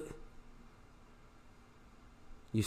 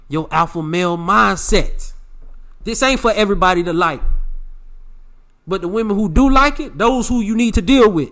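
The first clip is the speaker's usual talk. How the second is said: louder and higher, preaching hard; yelling; 4 semitones higher, 7 dB louder than usual. Real, not acted. Podcast host yelling at his listeners, fired up. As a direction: hammer the point home to the fellas. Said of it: "I don't wanna be yelling at y'all".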